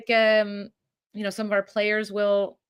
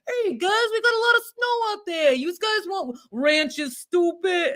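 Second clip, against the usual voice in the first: much higher hammed-up Buffalo accent, drawn out slowly; mock Buffalo accent; 9 semitones higher than usual. Mocking how movies do Buffalo locals, high hammy accent. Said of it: "it's always like".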